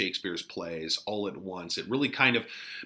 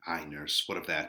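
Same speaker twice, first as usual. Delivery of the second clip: put-on softer, lower amused young Romeo; 7 semitones lower, 6 dB quieter than usual. Romeo answering the nurse's riddle, lower and softer.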